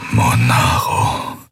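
low, slow, drugged slur; groggy, very slow and deep; lips like clay. A narrator's reading. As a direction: drag the name out, heavy-lipped and low. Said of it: "mit Lippen wie aus Lehm".

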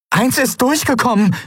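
high-pitched cry of alarm; calling out. Acted. Endriel alerts the crew to an intruder, crying out. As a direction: call out the danger, voice way up. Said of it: "rief sie".

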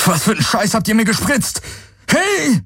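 high, fast, outraged yell; furious yelling. A narrator's reading. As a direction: shout it after your captors. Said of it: "brüllte Galen ihnen nach". (wütend hinterhergebrüllt)

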